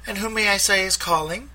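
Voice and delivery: high, bright phone greeting; young receptionist. A narrator's reading. Theater receptionist answering the phone, high polite voice. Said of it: "a young woman's voice answered".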